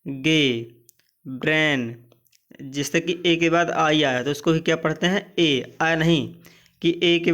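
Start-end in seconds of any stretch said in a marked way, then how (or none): 1.2-1.9 ब्रेन said crisply as "brain" with a falling end; example word. Shown as a demonstration. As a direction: model ब्रेन clearly. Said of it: "वे में मार्ग". (ब्रेन को स्पष्ट बोलना)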